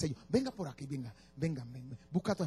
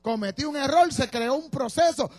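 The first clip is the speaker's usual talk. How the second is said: high, loud declaration of faith; full-force preaching; 9 semitones higher, 8 dB louder than usual. Acted. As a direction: build a driving list at full voice. Predica a toda fuerza. (predicación encendida, a toda voz)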